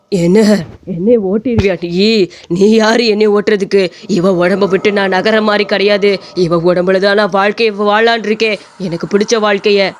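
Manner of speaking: put-on ghost voice, refusing to leave; defiant possessing ghost. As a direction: challenge the exorcist as a stubborn spirit. (மிரட்டும் பேய்க் குரல்)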